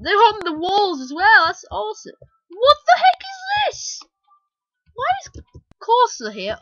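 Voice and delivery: high, loud, astonished exclaiming; amazed surprise. Real, not acted. Player discovers a hidden room of characters, astonished. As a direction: let the voice leap up in astonishment.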